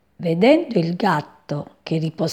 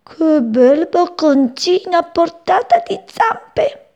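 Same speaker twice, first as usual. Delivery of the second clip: higher, slow, licking-its-lips cat voice; scheming cat thinking aloud, slow and high; sly greedy cat; 10 semitones higher, 4 dB louder, slower than usual. Acted. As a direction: savour every word like a hungry cat. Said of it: "leccandosi i baffi pensò".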